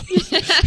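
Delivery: high, sudden laugh at the memory; one short laugh; burst of laughter. Real, not acted. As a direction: laugh out at the punchline. Laughs at his own story.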